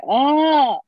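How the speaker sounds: loud, wordless groan of exasperation; drawn-out fed-up "ugh"; exasperated groan. Real, not acted. Exasperated, out of words.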